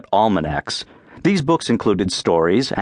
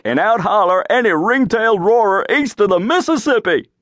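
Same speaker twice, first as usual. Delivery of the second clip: raised, higher boastful holler; hollering brag; 8 semitones higher than usual. A narrator's reading. Davy hollering his tall-tale boasts to all. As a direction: crow it out like a frontier braggart. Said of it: "liked to holler".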